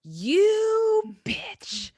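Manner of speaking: high, sneering villain voice; villain taunt. Acted. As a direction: spit it out like a gloating villain. Acted villain insult in story.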